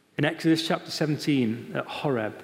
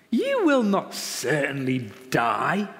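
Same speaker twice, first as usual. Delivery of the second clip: crafty serpent; slower than usual. A narrator's reading. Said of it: "the serpent said to the woman".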